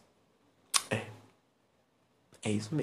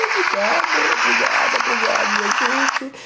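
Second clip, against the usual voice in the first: squeaky thank-you voice; 7 semitones higher than usual. Pretend.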